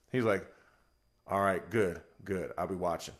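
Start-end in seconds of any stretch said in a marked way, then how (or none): 1.3-3.1 low, quiet man of few words; understated, falling away softly; Marvell. Retold or imitated. Teammate checking on the nervous starter, low-key and terse.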